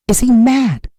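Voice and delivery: usual speaking voice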